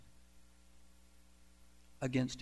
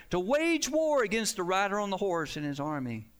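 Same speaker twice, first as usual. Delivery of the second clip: loud, high scripture proclamation, building; preaching at full force; 4 semitones higher, 3 dB louder than usual. Acted. Preacher reading Revelation to the church, loud proclamation. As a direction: cry the verses out over the congregation.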